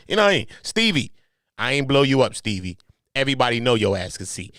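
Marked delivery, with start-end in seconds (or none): none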